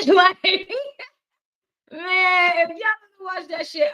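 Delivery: high, drawn-out exclamation with a laugh; excited raving. Real, not acted. Host raves to viewers about a documentary, excited raving.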